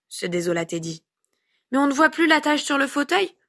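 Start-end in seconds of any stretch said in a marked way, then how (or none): 1.7-3.3 put-on high little-boy voice, rising; childish self-justifying tone; defensive child. A narrator's reading. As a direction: protest it in your own defence as a small kid. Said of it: "précisa-t-il pour sa défense".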